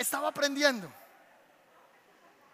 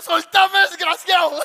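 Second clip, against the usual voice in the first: high, loud yelp for his finger; mock pained yelling; 8 semitones higher, 13 dB louder, slower than usual. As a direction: yell at the demon to let go, half laughing. Pastor con el dedo mordido. (grito fingido de dolor, agudo y fuerte)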